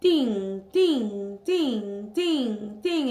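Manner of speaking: imitated phone alert chime, repeated; falling chime-like dings, evenly spaced; notification dings. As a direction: sing out each ding like an alert bell. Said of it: "notifications from Facebook".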